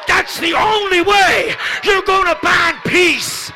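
loud, high, driving sermon climax; preaching at full force. Acted. Preacher urging the church to give up bitterness, shouting. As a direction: hammer each line home to the pews.

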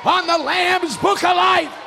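high, loud sermon climax; rousing preaching. Real, not acted. Preacher announcing salvation as the grand prize, rousing preaching. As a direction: build to a shouted proclamation.

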